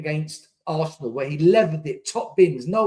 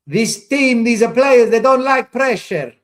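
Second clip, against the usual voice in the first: raised, emphatic, pitched up; loud rant; 6 semitones higher, 8 dB louder than usual. Real, not acted. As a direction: hammer the point home loudly. Fan ranting about his team.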